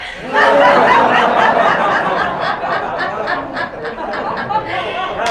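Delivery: big loud laugh let out; released laugh. Shown as a demonstration. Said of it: "let it out with a laugh".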